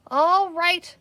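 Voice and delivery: sheriff's cry. Acted. Sheriff ordering the men again, loud call. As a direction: call it out to the crowd. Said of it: "cried the sheriff again".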